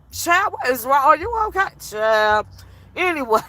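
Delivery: usual speaking voice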